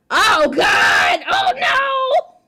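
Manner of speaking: loud, high frightened shout; panicked cry. Acted. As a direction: yelp in alarm as if caught. Character panics at Sayori's arrival.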